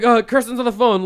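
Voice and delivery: high, loud panicked cry; freaking out. Retold or imitated. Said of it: "like, freaking out".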